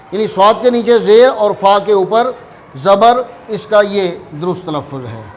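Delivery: ordinary talk, nothing marked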